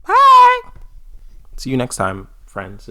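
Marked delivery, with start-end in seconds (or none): 0.0-0.6 tiny high-pitched squeak of a goodbye; squeaky bye. Pretend. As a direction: chirp it out in a squeaky voice. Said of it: "Say bye".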